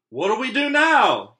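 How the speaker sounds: high, loud frightened pirate; panicked yell. Acted. Pirate asking the crew in the storm, yelling. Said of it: "yelled one of the pirates".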